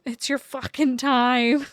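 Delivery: loud, slow, leaning hard on time; emphatic cheer. Real, not acted. Reader cheering her viewers on, loud and emphatic. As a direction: punch it out like a rallying cry.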